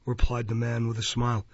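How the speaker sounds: ordinary delivery, nothing marked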